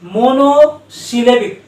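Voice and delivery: Monosyllabic drawn out slowly, each syllable clear; slow term demo. Shown as a demonstration. Teacher introducing a new term, saying it slowly. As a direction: stretch the term out. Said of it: "और ये है क्या चीज?".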